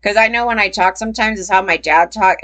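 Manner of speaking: ordinary talk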